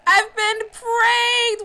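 high, slow, loud outraged yell; shocked shout. Real, not acted. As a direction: scream it out in disbelief. Prank reveal shout.

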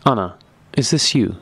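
ordinary delivery, nothing marked

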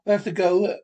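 alarmed shout to the group; urgent shout. Acted. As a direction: push everyone out now. Fleeing danger underground.

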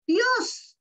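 high exclaimed cry of joy; exclamation. Acted. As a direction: call it out high, as the angel. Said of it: "esos signos de admiración".

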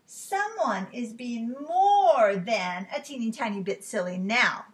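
slow, emphatic telling-off; storybook teacher putting her foot down; scolding teacher. Acted. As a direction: draw it out loudly like a stern teacher. Teacher scolds, hands on hips.

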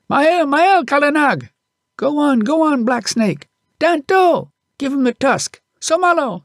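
high, loud yells urging the elephant on; boy's high shouts. A narrator's reading. Little boy yelling to his elephant in the fight, high and loud. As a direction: shout encouragement at the top of his voice. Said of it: "he would shout".

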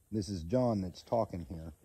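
ordinary talk, nothing marked

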